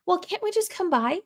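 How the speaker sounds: high, pleading mom impression; mom's voice. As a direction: whine it like mom asking. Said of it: "My mom would be like".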